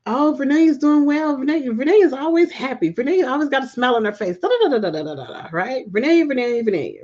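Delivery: breezy mock of what people say; imitating other people. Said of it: "some people automatically assume".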